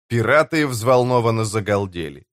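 ordinary delivery

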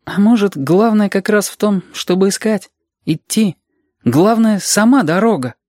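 put-on high, musing child voice; thoughtful child. A narrator's reading. Reshka pondering why they travel, thoughtful child voice. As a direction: muse aloud, pitched high as a child. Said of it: "изрёк задумчиво".

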